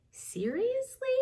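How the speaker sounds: high, rising seriously; amazed question. Acted. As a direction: gasp it in wonder. Narwhal amazed at the story.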